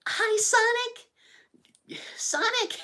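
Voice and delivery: put-on very high, drawn-out girlish falsetto; Amy. Streamer impersonating her greeting Sonic, high falsetto Amy. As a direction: squeal it sweetly up high. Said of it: "Amy's so annoying all the time".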